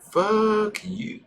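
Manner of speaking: high falsetto playful insult; mock falsetto comeback; squeaky retort. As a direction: squeak it out teasing.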